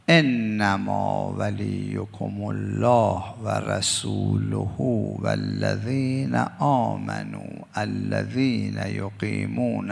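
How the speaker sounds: slow, drawn-out recitation of the verse; measured Quranic recitation. Acted. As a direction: intone the verse slowly and reverently.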